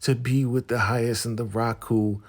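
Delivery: high mocking gush, sugary and sarcastic; mock their fake cheer. Wives mocking the husband's happy talk, sugary fake gush. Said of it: "sit down and like".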